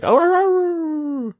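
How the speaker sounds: long, high, slow drawn-out howl; imitating a crowd howl. A wrestler entering the ring howls to the crowd, long and high. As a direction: howl it up high and stretch every oh. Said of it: "she starts howling to the crowd".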